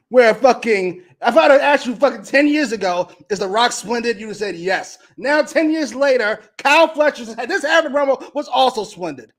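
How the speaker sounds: loud, high-pitched mocking rant; exasperated sarcasm. Real, not acted. Ranting at co-host's praise of a promo, exasperated sarcasm.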